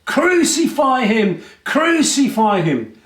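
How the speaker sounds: slow, high mob chant; crowd shouting. Acted. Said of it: "they started to shout".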